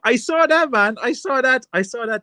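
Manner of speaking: high, loud burst of delighted recognition; excited laughing. Real, not acted. Reacting to the work-boots joke, excitedly delighted.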